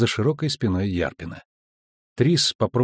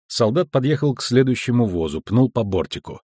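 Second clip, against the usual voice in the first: plain reading voice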